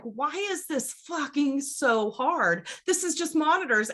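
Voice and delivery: high, pleading frustration; exasperated. Retold or imitated. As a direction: cry out at the silly problem. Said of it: "and you're just like".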